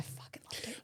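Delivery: gleeful secret whisper. Real, not acted. Telling friend about the fight, whispered. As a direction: breathe it like a guilty confession.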